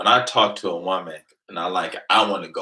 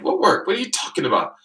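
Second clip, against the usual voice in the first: confused high question; 12 semitones higher, faster than usual. Retold or imitated. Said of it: "I'm like".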